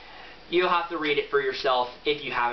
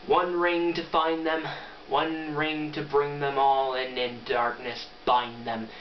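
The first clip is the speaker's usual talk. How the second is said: solemn recitation; 3 semitones lower, slower than usual. Pretend. Vlogger reciting the ring poem from memory, solemn and slow. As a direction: intone it like an ancient verse. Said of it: "Is it sad that I actually got that fairly well memorized".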